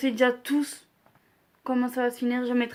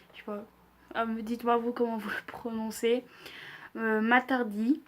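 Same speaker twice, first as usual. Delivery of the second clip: ordinary delivery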